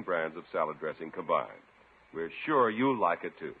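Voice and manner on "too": ordinary delivery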